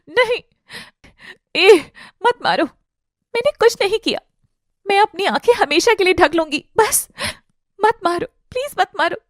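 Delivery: high-pitched, broken begging; panicked pleading. Acted. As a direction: plead in terror between gasps. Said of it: "बौखला उठती है".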